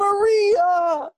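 high, loud hammed-up sob; mock wailing. Fernando wails for Maria one last time. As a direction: howl her name in fake despair. Final wail.

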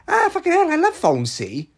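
high, hammed-up Welsh woman; mock a loud Welsh phone lover; Welsh accent. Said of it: "she was this Welsh woman".

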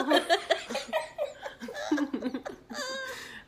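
long high-pitched laugh. Real, not acted. Host cracking up at the devil answer, high laugh. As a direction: burst out in high giggles.

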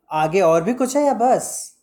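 commanding tone. A narrator's reading. Shyama pressing Ram Nihal to finish his story, commanding. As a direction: ask it as an order. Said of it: "Shyama ने आज्ञा भरे स्वर में कहा".

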